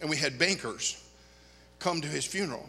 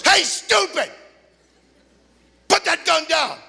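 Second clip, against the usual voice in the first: high, loud furious shout; angry yell; 12 semitones higher, 8 dB louder, slower than usual. Retold or imitated. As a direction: roar at him to drop the gun. Friend yelling at shooter.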